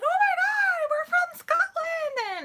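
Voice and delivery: very high and loud, almost crying with joy; excited fan squeal. Retold or imitated. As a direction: squeal it like a starstruck fan who can't believe it. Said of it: "got all excited and flustered".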